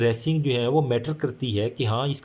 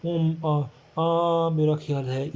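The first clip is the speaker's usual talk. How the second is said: slow, halting, nervous ums before the words "मेरा ख्याल है"; panicky hesitation; 4 semitones higher, slower than usual. Shown as a demonstration. Teacher shows students a panicked speaker, slow and hesitant. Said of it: "panic नहीं होना".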